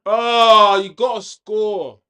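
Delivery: long loud Oh, then slow high you gotta score; real frustrated shout. Real, not acted. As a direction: yell at the missed chance, stretched out. Chance missed during match.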